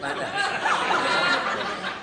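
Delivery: high, loud mock sneer; mock disdainful look noise. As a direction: pull the wife's face with a squeaky sound. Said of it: "Look at it".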